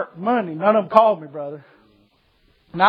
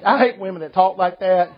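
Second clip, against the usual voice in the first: usual speaking voice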